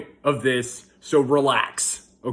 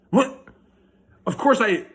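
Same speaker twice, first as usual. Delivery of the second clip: high-pitched incredulous what; mock disbelief; 7 semitones higher than usual. Dad questioning a cliche he said, incredulous. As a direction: blurt it out in baffled disbelief.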